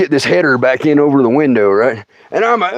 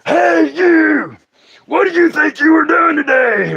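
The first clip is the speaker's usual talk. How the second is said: bullhorn thumb; 12 semitones higher, slower than usual. Retold or imitated. The thumb yelling at him after he rolled on it, bullhorn shout. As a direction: blast it through a bullhorn.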